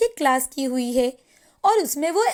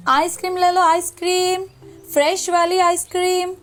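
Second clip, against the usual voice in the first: higher-pitched, chanting hawker's call; street vendor's cry; 4 semitones higher than usual. Acted. Maya hawking ice cream to passers-by, vendor's cry. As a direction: call out your wares on a level, sustained note.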